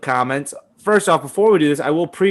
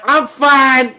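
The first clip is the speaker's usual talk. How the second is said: put-on kid voice; 9 semitones higher, 5 dB louder than usual. Quoting the kid.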